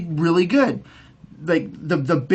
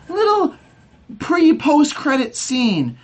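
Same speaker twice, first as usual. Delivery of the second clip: scornful squeak; 8 semitones higher, slower than usual. Real, not acted. Mocking the tiny scene.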